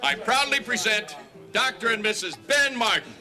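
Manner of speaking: loud, proud presenting the couple; formal announcement. Acted. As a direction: announce them like a master of ceremonies.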